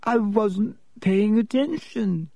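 high, slow, theatrical wail; dramatic. A narrator's reading. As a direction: stretch it out with great drama. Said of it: "said Myrtle dramatically".

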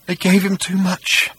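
high, slow whimpering voice; tiny whimper. A narrator's reading. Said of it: "he said in a tiny whimper".

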